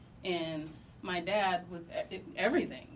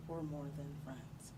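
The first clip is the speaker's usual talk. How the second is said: much softer, lower, barely getting it out; quiet confession; 5 semitones lower, 11 dB quieter than usual. Retold or imitated. Confessing to his dad, softer and lower. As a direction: let the words drop almost to nothing.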